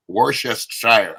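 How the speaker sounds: Worcestershire sounded out slowly as "wor-chest-shire"; struggling pronunciation. Shown as a demonstration. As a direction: labour through Worcestershire piece by piece, ending in a full "shire". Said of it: "I can't say it".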